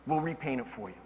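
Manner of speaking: lightly voiced calm crew chief. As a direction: say it low and easy. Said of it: "He says".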